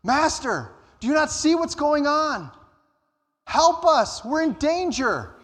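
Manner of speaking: high, panicked plea to the master; frightened cry for help. Acted. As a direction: cry out for rescue in a storm. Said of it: "The disciples cry out to Jesus".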